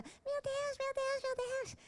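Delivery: high, soft desperate whimper; desperate friend crying out to God, high and soft. Retold or imitated. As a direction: moan it up high in despair. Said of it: "ela tava lá desesperada".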